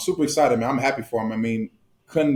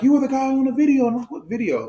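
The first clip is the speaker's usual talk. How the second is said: high-pitched mimicked fan recognition; excited fans; 13 semitones higher than usual. Player retelling fans spotting his teammate, high imitation voice. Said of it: "then they were like".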